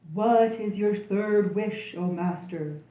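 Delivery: loud, slow, low genie voice; a slow, resonant genie at his master's service; booming genie. A narrator's reading. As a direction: boom it out like a grand genie.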